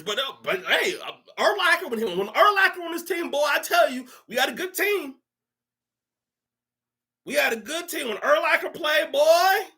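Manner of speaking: high-pitched fan boasting; mock clueless fan. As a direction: brag in a silly high voice.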